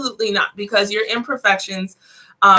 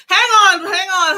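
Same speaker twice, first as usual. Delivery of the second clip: laughing; 9 semitones higher, 5 dB louder than usual. Real, not acted. Laughing, asking viewers to wait.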